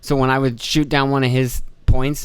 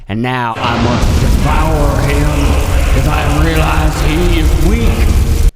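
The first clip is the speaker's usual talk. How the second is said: much louder, very slow hammed-up evil overlord; mock villain; 2 semitones higher, 8 dB louder, much slower than usual. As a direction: declare it like a cartoon villain plotting to devour. Mock villain about his boss.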